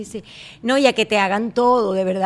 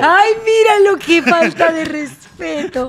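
mock offense; 6 semitones higher, 6 dB louder, slower than usual. Mock offense at bad English.

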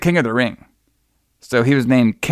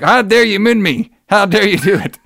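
higher-pitched indignant imitation; high, put-on indignation; mock outrage; 7 semitones higher than usual. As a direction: act out an offended wrestler protesting. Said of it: "Rick Rude's so mad that he did that".

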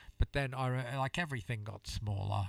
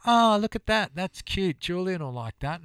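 cooing gift-giver; 7 semitones higher, 6 dB louder than usual. Retold or imitated. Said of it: "someone who thinks".

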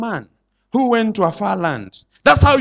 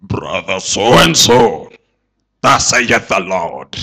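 much lower, slow, thundering pronouncement; slow deep shouted oracle; booming prophet voice; 9 semitones lower, much slower than usual. Retold or imitated. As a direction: boom it like a prophet from above. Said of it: "speak in a loud voice and say".

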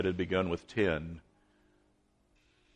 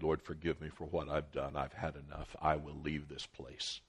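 hushed prayer; 5 semitones lower, 12 dB quieter than usual. Retold or imitated. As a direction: murmur it to God as if giving up. Quoted prayer of a weary pastor.

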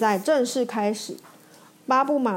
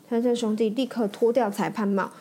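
plain reading voice

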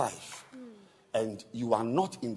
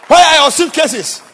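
loud demand; 22 dB louder than usual. Retold or imitated. Bishop demanding loudly.

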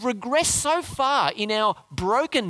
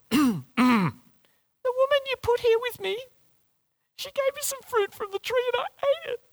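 high falsetto mock-whiny Adam; funny voice. Preacher acts out Adam blaming the woman, funny falsetto voice. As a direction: put on a silly, very high-pitched voice. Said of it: "you probably said it in a funny voice".

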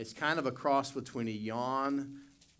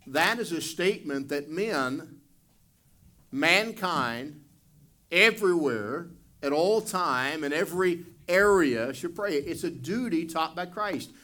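louder, higher, building preacher's fervour; fiery preaching; 6 semitones higher, 6 dB louder than usual. Real, not acted. Pastor pressing the congregation on prayer as command, fervent and loud. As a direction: drive the point home to the pews.

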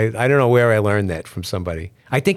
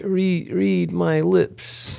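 mock mother's joke; 8 semitones higher, much slower than usual. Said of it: "she said".

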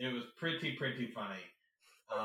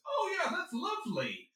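very high sugary praise; mock-sweet approval; imitating actor. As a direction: gush it falsely. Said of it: "And he goes".

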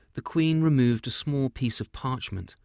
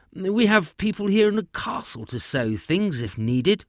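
king voice; 7 semitones higher than usual. Acted. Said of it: "he would say".

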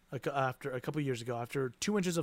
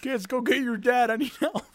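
high, loud British grandpa; old man calling for help; 8 semitones higher, 6 dB louder than usual. Acted. Grandpa stuck on caramel sending kids, loud distress. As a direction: call out in comic distress.

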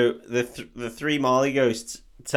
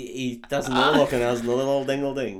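ordinary talk

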